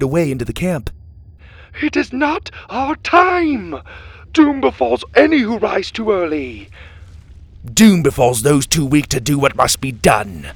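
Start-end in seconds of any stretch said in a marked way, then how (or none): 1.8-6.7 high, loud cry of doom; desperate cry. A narrator's reading. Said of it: "the man cried". 7.6-10.5 loud, hard comeback; forceful retort. A narrator's reading. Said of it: "Kai shot back".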